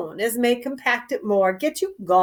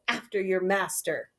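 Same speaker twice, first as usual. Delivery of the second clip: usual speaking voice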